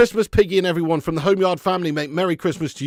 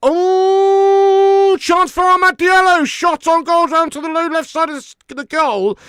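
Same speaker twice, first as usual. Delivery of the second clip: much higher and louder, like a live commentator; sudden burst of match commentary; excited commentary; 14 semitones higher, 8 dB louder, slower than usual. Real, not acted. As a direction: call the chance as it happens. Goal chance during match.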